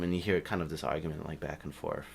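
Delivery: ordinary delivery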